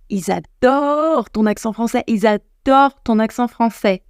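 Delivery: drawn-out, gushing stress on "adorent"; emphatic gushing. Real, not acted. Reassuring learners that English people love their accent, emphatic gushing. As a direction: stretch "adorent" slowly and lovingly.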